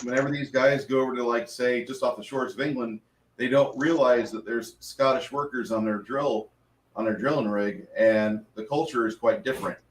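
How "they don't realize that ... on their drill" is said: normal talking voice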